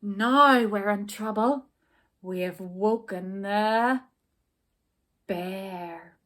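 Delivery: a touch of dread, slowing at the end; mildly fearful; mild despairing cry. Acted. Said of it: "they all cried in despair".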